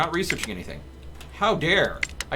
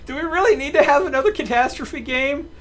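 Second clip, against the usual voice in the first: higher and louder, fed-up griping; exasperated complaint; 10 semitones higher, 7 dB louder than usual. Real, not acted. Streamer griping to chat about another disaster, exasperated. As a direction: whine about yet another catastrophe. Groans at new game disaster.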